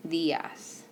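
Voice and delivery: DIAS said softly, D like "th" in "this"; soft Spanish D. Shown as a demonstration. Teacher repeating "Dias" for students, soft D. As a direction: let the D of "Dias" soften to th. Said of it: "it's a little bit softer".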